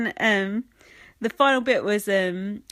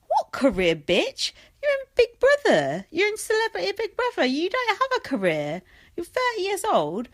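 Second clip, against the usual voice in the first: scornful rant; 11 semitones higher than usual. Real, not acted. Vlogger ranting at a washed-up footballer on TV, high and scornful. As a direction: tear into him with mock disbelief.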